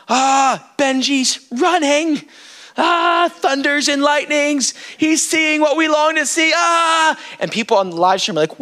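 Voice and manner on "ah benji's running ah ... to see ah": raised-pitch rapturous wonder; high, overwhelmed sighs of amazement; acted awe. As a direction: exclaim as if watching a vision unfold. Acts out people's awed thoughts in worship.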